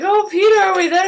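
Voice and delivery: high-pitched imitation of a clueless passenger; waking Walter. Passenger wakes up mid-shooting, high mock-clueless voice. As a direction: put on a high, innocent questioning voice.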